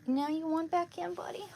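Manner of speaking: high-pitched question to a pet; talking to buddy. Real, not acted. Speaker asks buddy about coming back in, high pet talk. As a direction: lift the pitch as if asking a little animal.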